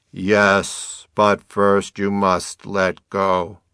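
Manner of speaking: loud, slow divine command; measured, thunderous decree; booming voice of God. A narrator's reading. God's booming voice answers the hiker.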